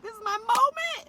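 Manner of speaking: very high, loud mock yell; re-enacted ecstatic shout; excited yell. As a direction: squeal it like a thrilled fan. Retold excited realisation at concert.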